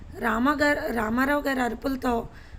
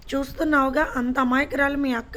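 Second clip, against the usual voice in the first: ordinary delivery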